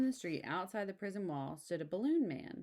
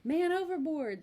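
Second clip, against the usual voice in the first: loud, high shout of alarm; sailors' cry; 10 semitones higher, 7 dB louder than usual. Acted. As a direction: yell it across the deck. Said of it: "the sailors cried".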